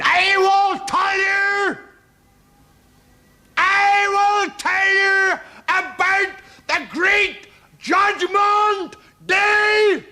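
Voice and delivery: high, slow, loud ranting preacher; fire and brimstone preacher. Acted. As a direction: thunder it from the pulpit, dragging each word. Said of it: "fire and brimstone preachers".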